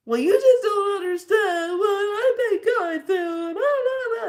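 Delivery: high, slow, sing-song wail of self-pity; crying protest. Retold or imitated. To the elder, tearfully protesting her pain. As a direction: whine it out through tears. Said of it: "And I was just a crying".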